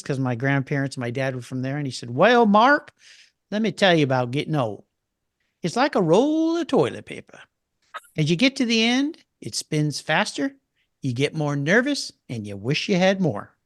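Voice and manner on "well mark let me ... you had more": lightly put-on Texas drawl, slow in the middle; Bill Neal Texas drawl. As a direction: imitate an old Texan telling a joke, a hint of Texas accent. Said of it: "in his Texas drawl".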